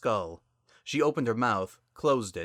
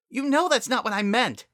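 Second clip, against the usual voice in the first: much higher, louder wounded cry; upset protest; 7 dB louder than usual. Acted. Sunset pleading with her sister, a high, loud wounded protest. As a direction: protest that she was misread.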